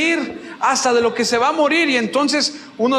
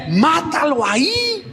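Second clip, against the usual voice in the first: soldier urging the kill; 6 semitones higher, slower than usual. Retold or imitated. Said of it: "sus soldados le dicen".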